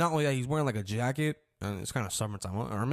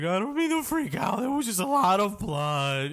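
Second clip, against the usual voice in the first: high, loud, panicky voice, slowing at the end; freaking out; 8 semitones higher, 5 dB louder than usual. Retold or imitated. The mother after the dog attack, imitating her high panicked voice. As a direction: imitate the mother losing it in fear. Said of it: "she's freaking the fuck out".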